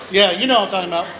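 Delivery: ordinary delivery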